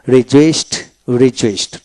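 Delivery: রিজয়েসড said rejoist, ending in t; final ED as T. Shown as a demonstration. Teacher showing the past ending, রিজয়েসড with final t. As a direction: close each রিজয়েসড on t, not d. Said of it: "ট উচ্চারিত হবে".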